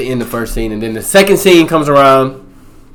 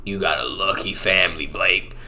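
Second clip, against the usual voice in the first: creepy stranger; 5 semitones lower, 10 dB quieter than usual. Retold or imitated. Ranger's son to Blake, quiet creepy low voice. Weird son eyes the family.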